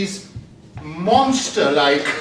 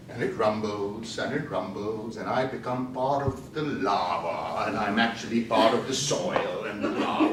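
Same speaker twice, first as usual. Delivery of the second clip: hushed; 10 semitones lower, 8 dB quieter than usual. Acted. Sinking into the earth.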